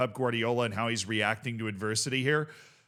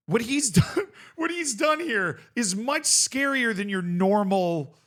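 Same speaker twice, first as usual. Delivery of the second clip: laughing; 7 semitones higher, 3 dB louder, slower than usual. Real, not acted.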